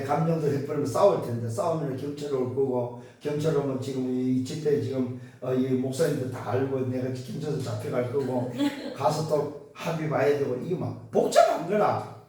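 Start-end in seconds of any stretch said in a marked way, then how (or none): none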